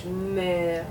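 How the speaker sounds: "Mère" drawn out through a barely open mouth; mouth almost closed. Shown as a demonstration. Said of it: "mouth stays almost closed".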